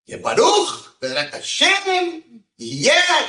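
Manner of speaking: put-on very high, strained old rabbi voice, slow; old rabbi's blessing. Rabbi blessing the girl who has no lines, in a high old voice. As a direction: croak out a blessing like an elderly rabbi.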